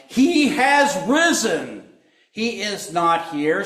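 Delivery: high, loud, slow proclamation; proclaiming. Acted. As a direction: announce it with awe. Angel's announcement, sermon.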